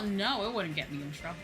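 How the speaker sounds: ordinary talk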